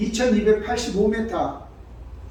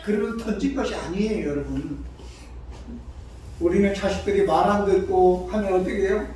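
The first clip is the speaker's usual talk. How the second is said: usual speaking voice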